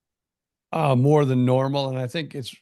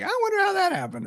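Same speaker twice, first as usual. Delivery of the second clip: quick, much higher mock musing; mock wondering; much faster than usual. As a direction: toss off a playful, curious wonder. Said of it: "you always kinda like".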